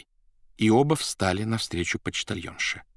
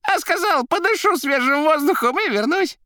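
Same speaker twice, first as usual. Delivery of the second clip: high, loud, rattling patter; crackling chatter; 6 dB louder than usual. A narrator's reading. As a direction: rattle it off like a jester. Koroviev on phone.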